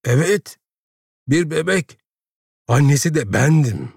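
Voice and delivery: high-pitched, defiant declaration. A narrator's reading. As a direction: fling each phrase out as a challenge. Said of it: "meydan okurcasına". (meydan okurcasına, tiz sesle)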